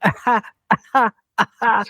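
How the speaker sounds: terrible imitation of a wheezy, halting laugh; George McFly laugh impression. Podcast host mimics the actor's odd laugh for cohosts, mock halting laugh. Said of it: "I'm gonna do a terrible impression".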